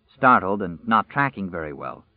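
ordinary delivery

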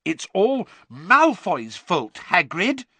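earnest. A narrator's reading. Hermione telling Hagrid he is blameless, earnestly. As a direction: press it earnestly, pitched well up. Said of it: "said Hermione earnestly".

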